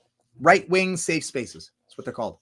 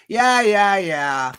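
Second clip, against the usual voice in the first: loud, higher yeahs that drop away; dismissive brush-off; 3 semitones higher, 5 dB louder, much slower than usual. Retold or imitated. Imagining Rage humoring him, mock dismissive. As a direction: imitate someone waving it off. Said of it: "he was just like".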